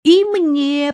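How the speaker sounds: high, loud little request; asking eagerly. A narrator's reading. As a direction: pipe up to be included. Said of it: "попросил Иваныч".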